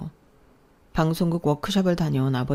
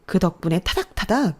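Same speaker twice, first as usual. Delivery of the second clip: higher, louder spoiled sister nagging her sibling; bratty sister yelling; 5 semitones higher, 6 dB louder than usual. Acted. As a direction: snap at your older sibling like a spoiled diva. (짜증 내는 여동생 목소리)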